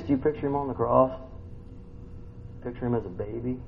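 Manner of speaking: ordinary delivery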